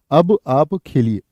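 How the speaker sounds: अब आप खेलिए said slowly, word by word, for the class; slow dictation of the corrected sentence; slow model sentence. Shown as a demonstration. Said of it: "खेलो नहीं".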